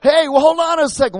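high, shrieking demon voices, hammed up; panicked demons. Demons shrieking at Jesus on the shore, hammed-up panic. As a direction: squeal like cornered demons begging. And these demons were there going.

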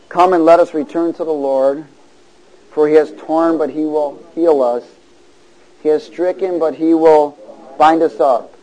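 loud, emphatic declaiming; forceful call-and-response reading; preacher's scripture reading. Real, not acted. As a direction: proclaim the verse, pausing between phrases for the congregation. Reads scripture to congregation.

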